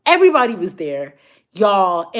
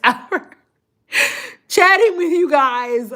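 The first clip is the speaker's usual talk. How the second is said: laughing; 7 semitones higher, slower than usual. Real, not acted.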